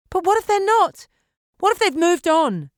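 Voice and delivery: high, loud demanding question. A narrator's reading. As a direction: press him hard, voice up and loud. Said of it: "she demanded".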